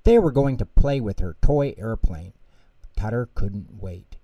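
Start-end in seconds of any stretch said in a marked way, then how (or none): none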